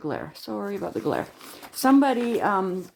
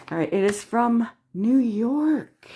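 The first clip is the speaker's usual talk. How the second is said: ordinary delivery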